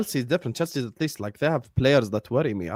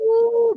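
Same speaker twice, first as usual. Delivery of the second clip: mock ooh. Real, not acted. Mock reaction.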